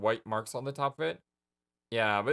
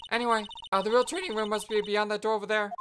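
put-on perky girl voice; 9 semitones higher than usual. Perky sidekick voice.

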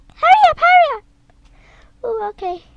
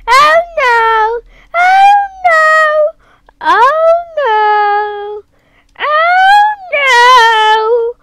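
rising panic, from crying "oh no" to loud higher shouts; panicking; 6 semitones higher, 5 dB louder, much slower than usual. Real, not acted. Stuck on the map, panicking, building to crying shouts. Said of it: "I feel I'm panicking right now".

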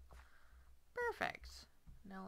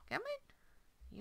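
high coaxing call. Shown as a demonstration.

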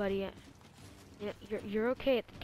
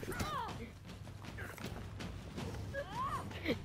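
high laugh; 10 dB quieter than usual. Real, not acted. Laughing at the game.

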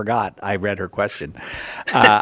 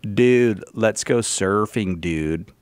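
put-on slow surfer-dude take on an American accent; mock American accent; 3 semitones lower, much slower than usual. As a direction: imitate Australians faking an American accent, dropping low at the end. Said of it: "This is their American accent".

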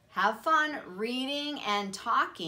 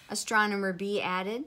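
ordinary delivery

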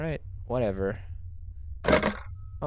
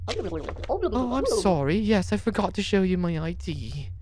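high visitor voice; 5 semitones higher than usual. Acted. Voicing visitor without ID.

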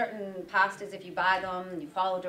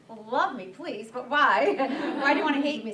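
laughing; 5 semitones higher, 6 dB louder than usual. Retold or imitated. Retelling banter with chef, laughing.

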